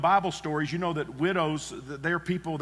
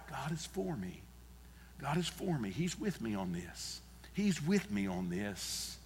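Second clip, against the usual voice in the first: hushed, softer self-assurance; soft whisper; 10 dB quieter than usual. Shown as a demonstration. Preacher showing a worried listener how to whisper, hushed. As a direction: murmur it gently to yourself. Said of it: "just whisper to yourself".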